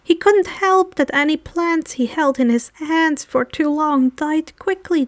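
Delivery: ordinary delivery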